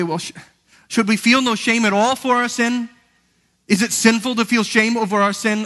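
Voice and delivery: questioning listener. Retold or imitated. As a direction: voice the congregation's worried question. Voicing a doubting listener's objection.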